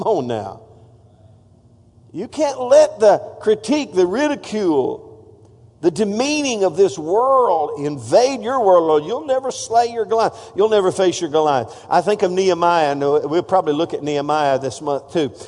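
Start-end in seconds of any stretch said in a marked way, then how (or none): none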